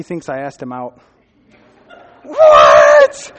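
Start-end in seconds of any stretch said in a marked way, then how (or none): 2.2-3.3 high, loud cry of disbelief, laughing; shocked laughing shout. Retold or imitated. Retelling the friend's reaction to the date mixup, laughing shout.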